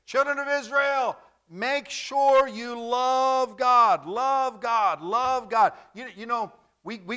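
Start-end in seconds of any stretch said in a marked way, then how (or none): 0.0-5.7 high shout, drawn out slowly; crying out loud. Retold or imitated. Moses crying loud to the children of Israel, high shout. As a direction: cry out like a prophet pleading with a crowd. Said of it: "Moses is crying loud".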